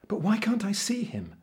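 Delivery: put-on high-pitched boy's question; curious cabin boy. A narrator's reading. Cabin boy asks about the invisible kobold, curious. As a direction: ask in a high, wondering young voice.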